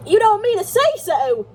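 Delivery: high, loud, drawn-out exclamation; horror-struck cry. A narrator's reading. Levin hears his brother is back, horror-struck. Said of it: "cried Levin, horror-struck".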